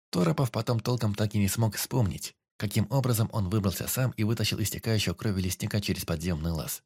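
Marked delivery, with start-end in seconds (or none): none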